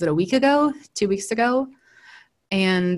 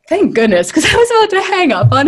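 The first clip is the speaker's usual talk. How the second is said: relieved exclamation; 11 semitones higher, 10 dB louder than usual. Retold or imitated. Relief after scary caller's praise.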